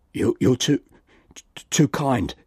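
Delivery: halting, stammered repeats; stuttering. A narrator's reading. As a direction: stammer out the words. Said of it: "stuttered the man".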